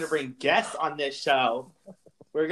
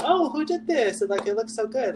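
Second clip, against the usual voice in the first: high admiring voice; 10 semitones higher, 4 dB louder than usual. Pretend. Imagined admirer quoted.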